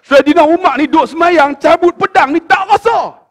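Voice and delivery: loud, high shout of wonder; amazed exclamation. Real, not acted. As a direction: exclaim it in awe. Preacher exclaims in awe. (seruan kagum yang lantang)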